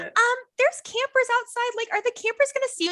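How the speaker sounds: very high, hurried timid voice; nervous concern. Retold or imitated. Junior counselor spotting smoking near campers, retold nervous concern.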